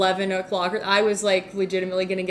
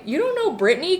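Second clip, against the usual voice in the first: mock surprised question; 8 semitones higher than usual. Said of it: "and then Bean's like".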